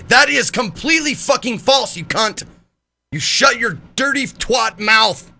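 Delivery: loud, high, drawn-out mock fury; mock outrage. Streamer jokingly cursing out a viewer over "not family friendly", mock outrage. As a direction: blast each word in hammed-up anger. Mock outburst at a chat comment.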